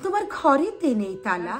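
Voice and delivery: measured, musical Bengali poem recital; poetry recitation. Acted. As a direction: recite the verse expressively, lifting the pitch.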